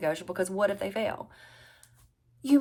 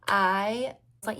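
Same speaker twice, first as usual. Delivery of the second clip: usual speaking voice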